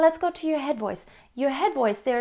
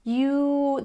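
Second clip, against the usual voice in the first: ordinary talk, nothing marked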